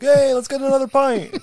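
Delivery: high, loud pub-mate cheer; mock merry call. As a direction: imitate a drunk new pal rallying everyone for a drink. Acts the merry Englishman calling out.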